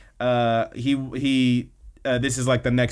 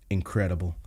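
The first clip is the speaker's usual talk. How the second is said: low, quiet deadpan; understated dry reaction, pitched down; deadpan verdict; 5 semitones lower, 5 dB quieter than usual. Retold or imitated. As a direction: drop it softly, mock unimpressed. Deadpan verdict on a bad speech.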